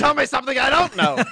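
mock indignant. Mock retort to bad impressions.

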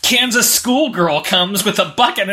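ordinary talk, nothing marked

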